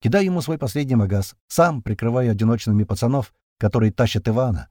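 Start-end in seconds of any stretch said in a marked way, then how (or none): none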